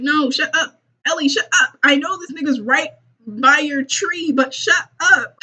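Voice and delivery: high-pitched, comic pleading to shut up; exasperated scolding. Pretend. Host telling Ellie to hush near the zombie, comic exasperation. Scolds a noisy character in story.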